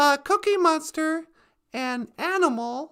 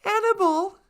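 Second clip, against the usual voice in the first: ordinary delivery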